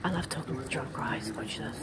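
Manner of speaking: very low, hushed aside to viewers; conspiratorial aside. Real, not acted. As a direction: drop your voice and confide. Secret aside before approaching drunk men.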